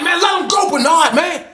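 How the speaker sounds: high shouted plea; retold yell. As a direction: scream at him to let go. Yelling at attacker to let go.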